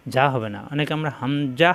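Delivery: usual speaking voice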